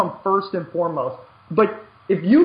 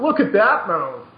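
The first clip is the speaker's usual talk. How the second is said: laughing, slow and higher, savouring each word; mock self-admiration; 4 semitones higher than usual. Mocking lazy players who only admire their own plays, laughing and drawn out. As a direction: ham up a smug player gloating over his own replay.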